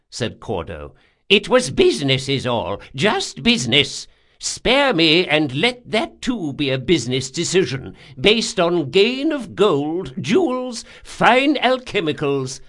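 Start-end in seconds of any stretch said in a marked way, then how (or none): 1.3-12.6 put-on high, loud old man's bargaining; pleading old man. Old man offers gold to be spared, high pleading. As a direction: plead for your life, pitch climbing.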